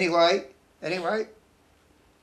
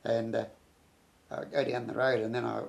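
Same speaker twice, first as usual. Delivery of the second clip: ordinary talk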